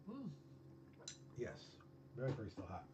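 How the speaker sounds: talking between sips of tea; sipping hot tea. Real, not acted. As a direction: sip hot tea while speaking. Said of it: "my tea is still very hot".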